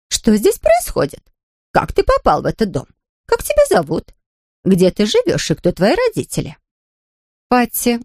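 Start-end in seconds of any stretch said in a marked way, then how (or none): 0.1-6.6 stern housekeeper. A narrator's reading. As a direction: scold the caught boy. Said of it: "строго спросила". (строго)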